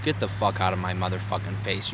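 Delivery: usual speaking voice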